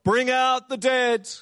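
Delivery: high, drawn-out street crier's call; crying out. Retold or imitated. Cart man ringing a bell through town, crying out. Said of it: "ringing a bell and crying out".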